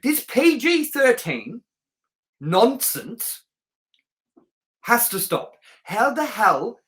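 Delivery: slowed-down, indignant complaint; exasperated rant. Real, not acted. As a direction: lean into it like you're fed up. Ranting about the PG-13 rating.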